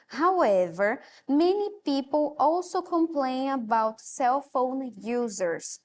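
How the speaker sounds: clear, measured class reading; careful reading for students to follow; reading aloud. Acted. Reads English text to class.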